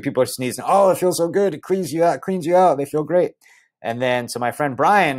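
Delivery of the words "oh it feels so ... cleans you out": happy tourist. Retold or imitated. Voicing happy sneezers.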